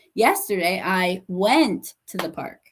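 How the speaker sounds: "went" stretched and stressed. Shown as a demonstration. Teacher modeling for the student, stressing "went". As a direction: linger on "went" so the student hears it. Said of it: "bringing emphasis on the incorrect part".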